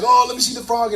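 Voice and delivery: high eager little cry; excited to see. Retold or imitated. Retelling the frog find to listeners, high eager cry. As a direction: beg to see it like a thrilled kid. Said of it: "we're excited".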